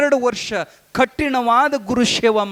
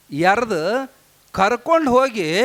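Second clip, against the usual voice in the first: normal talking voice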